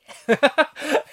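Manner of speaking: high, loud burst of laughter; real laugh. Real, not acted. Reviewer remembering a great twist, loud high laugh. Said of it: "I just laughing thinking about it now".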